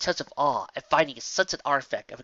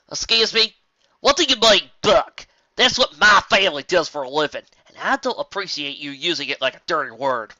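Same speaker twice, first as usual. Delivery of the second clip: higher and louder, indignant; offended farm pony; 4 semitones higher, 8 dB louder than usual. Acted. Farm pony confronting Rainbow over an insult, louder and indignant. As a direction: bristle at the insult to her family.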